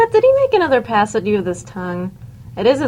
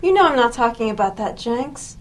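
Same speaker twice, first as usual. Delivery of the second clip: usual speaking voice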